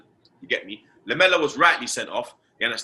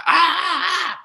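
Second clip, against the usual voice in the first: mock high yelps of pain, much higher; imitating a pained scream; 4 dB louder than usual. Fan retelling Lacazette going down with a mock scream. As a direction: ham up a footballer's anguished cry. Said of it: "he was like".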